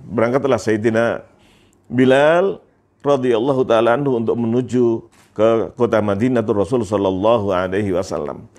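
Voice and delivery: usual speaking voice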